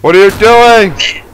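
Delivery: high, loud, drawn-out yell; alarmed shout. Real, not acted. Player seeing what a friend just did, alarmed shout. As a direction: shout in protest at your friend. Friend ruins things in game.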